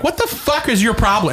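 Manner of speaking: high-pitched exasperated demand; retold angry outburst. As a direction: re-enact going off on someone. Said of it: "fucking went off on him".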